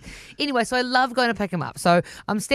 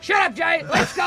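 higher, half-shouted brush-off; mock snap at son; 7 semitones higher than usual. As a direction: hurry the boy away, playfully sharp. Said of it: "I'm like".

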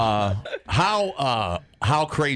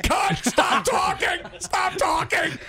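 very high, strained mock shout; imitated exasperated dad; slower than usual. Host voicing guest's dad halting the talk, mock exasperated shout. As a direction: yell it like a panicked dad stepping in. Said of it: "your dad right now is like".

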